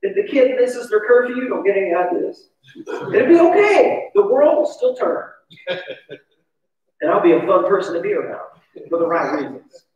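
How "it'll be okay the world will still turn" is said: slow, higher reassuring lift. Real, not acted. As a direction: stretch it out to calm everyone. Reassuring himself, slowed.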